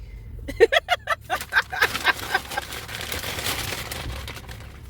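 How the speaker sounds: wheezing high laughter; long high laugh. Real, not acted.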